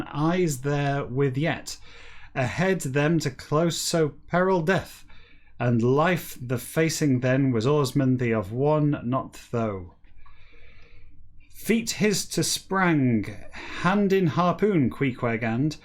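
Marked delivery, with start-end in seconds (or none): none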